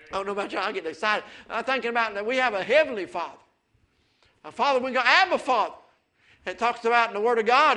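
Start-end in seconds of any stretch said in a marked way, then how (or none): 1.5-7.8 louder, higher preacher's call; fiery pulpit preaching; preaching at full force. Acted. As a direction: build the sermon up to a ringing shout. Preacher getting excited.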